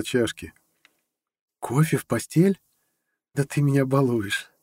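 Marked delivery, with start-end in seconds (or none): 1.6-4.5 put-on delighted girlfriend. A narrator's reading. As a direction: coo it high like a pampered girlfriend. Girlfriend delighted by coffee in bed. (довольный высокий девичий голос)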